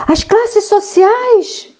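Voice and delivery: very high, drawn-out question in mock panic; mock terrified Mafalda. Mafalda alarmed by her classmate's news, mock terror. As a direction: squeal it slowly like an appalled cartoon girl. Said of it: "Aí ela fica apavorada".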